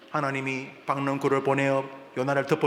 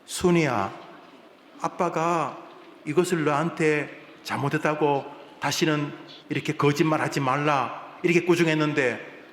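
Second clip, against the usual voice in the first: normal talking voice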